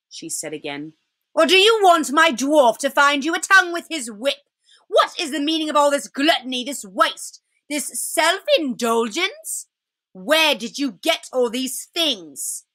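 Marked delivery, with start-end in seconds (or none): 1.3-12.6 higher-pitched witch, indignant and threatening; imperious, scolding witch. Acted. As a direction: interrogate them as a haughty queen. Witch questions the party.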